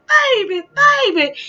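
very high, loud wailing sob; ugly crying. Retold or imitated. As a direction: wail it like a father breaking down. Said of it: "my dad was ugly crying".

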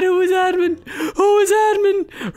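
a confused player asking, high; mock confused asking. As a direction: squeak the question, baffled. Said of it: "He said".

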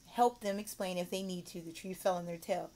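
normal talking voice

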